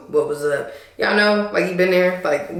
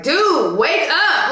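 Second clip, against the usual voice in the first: higher, louder call to wake him; retold yell; 9 semitones higher, 4 dB louder, much slower than usual. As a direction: shout at the dozing date to wake up. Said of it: "really loud and, like, screaming".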